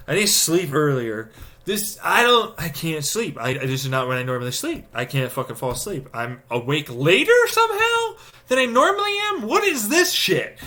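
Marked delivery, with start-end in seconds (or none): 6.1-10.6 much higher, strained, incredulous squeak; squeaky frustration. Real, not acted. Complaining to viewers about sleep, in a squeaky high voice. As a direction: squeal it out in disbelief.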